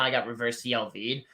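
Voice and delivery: ordinary delivery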